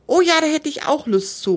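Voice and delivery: high-pitched keen exclamation; calling out eagerly. A narrator's reading. Girl agrees to Christmas market walk, calling out eagerly. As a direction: pipe up high, keen to join in. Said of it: "ruft Sandra".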